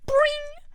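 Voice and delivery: very high cartoon spring noise; ping it out like hair shooting upright; springing "boing". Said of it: "his hair just stands on end".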